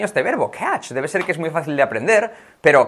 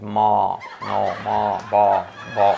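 low, scoffing ma ma ba ba; mock distrust; 6 semitones lower, 3 dB quieter, much slower than usual. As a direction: brush someone off with doubt. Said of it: "cuando desconfías de alguien". (burla desconfiada en voz grave)

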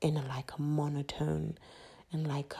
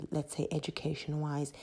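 normal talking voice

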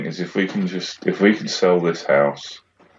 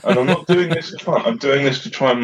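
laughing exasperation; 7 semitones higher than usual. Real, not acted. Laughing frustrated rant.

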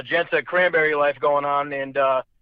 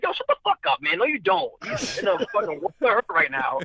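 inner scolding; 6 semitones higher than usual. Retold or imitated. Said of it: "And like".

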